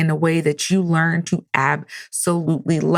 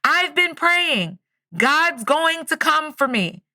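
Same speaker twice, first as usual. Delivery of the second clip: mock yelling back; 9 semitones higher, slower than usual. Man on roof refuses the rescue boat, mock yelling. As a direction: imitate yelling back across a distance. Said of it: "yells back".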